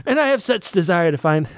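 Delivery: high, hammed-up kingly declaiming; pretentious storybook royalty; grandiose story reading. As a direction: read it aloud with mock royal pomp. Resumes reading royal dialogue.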